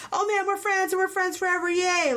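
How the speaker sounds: high, singsong mock enthusiasm; mock cheery kids. Critic imitating corny movie kids cheering their friendship, mock cheery.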